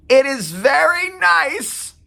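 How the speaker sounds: high, slow, loud and overly clear. A narrator's reading. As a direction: spell it out as if to a deaf person. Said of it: "She spoke slowly as if somehow Vicky had gone deaf".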